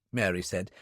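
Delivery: ordinary delivery, nothing marked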